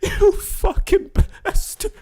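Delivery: slow, squealed through laughter; can barely get it out; laughing high curse. Real, not acted.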